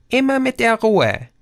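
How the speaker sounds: high, bright speaker voice from nowhere; Lautsprecherstimme. A narrator's reading. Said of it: "kam eine weibliche Stimme". (Stimme aus dem Lautsprecher, hoch und beruhigend)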